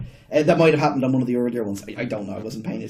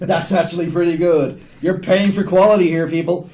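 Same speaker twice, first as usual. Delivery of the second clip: hammed-up sales pitch, louder; salesman pitch; 3 semitones higher, 7 dB louder than usual. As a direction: sell it like a TV ad. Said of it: "I feel like I'm selling it now".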